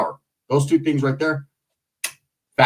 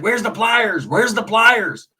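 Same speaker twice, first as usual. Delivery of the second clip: put-on frantic old man; 5 semitones higher, much slower than usual. Old man frantically demanding pliers.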